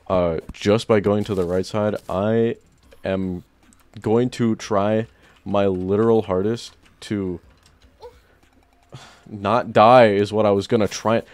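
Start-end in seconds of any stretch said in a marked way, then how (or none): none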